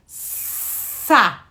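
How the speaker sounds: Sa as one clear blended syllable; syllable sound. Shown as a demonstration. Teacher blending a syllable for a child. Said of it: "o que que a gente tem?".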